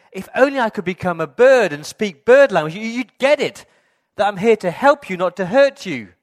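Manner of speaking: loud, high desperate cry; pleading shout. Retold or imitated. Dad begs the bird to understand, loud and high.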